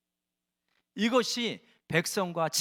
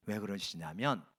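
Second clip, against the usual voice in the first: usual speaking voice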